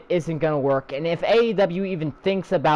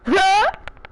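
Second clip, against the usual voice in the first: short high mocking laugh; scornful laugh; 12 semitones higher than usual. Real, not acted. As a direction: laugh it off in disbelief.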